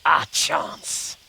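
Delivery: ordinary delivery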